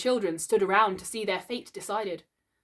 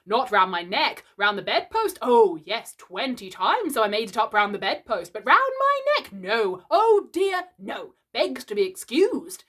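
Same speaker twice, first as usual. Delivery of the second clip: mock the tie with biting sarcasm; dangerously sarcastic; 3 semitones higher, 7 dB louder than usual. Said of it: "He became dangerously sarcastic".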